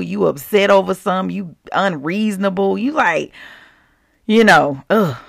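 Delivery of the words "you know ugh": ordinary talk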